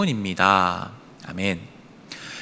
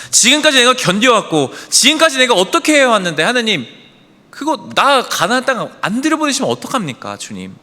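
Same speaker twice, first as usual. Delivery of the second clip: acted pleading complaint, pitched up; aggrieved protest; 5 semitones higher than usual. Moses protesting to God, aggrieved and higher. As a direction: plead your case in exasperation. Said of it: "하는 게 모세의 마음이었을 겁니다".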